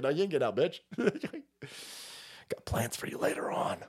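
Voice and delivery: soft menacing whisper at the end; carjacker voice. Retold or imitated. Comic playing the carjacker, whispered menace. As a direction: growl then whisper a threat. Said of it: "It's like".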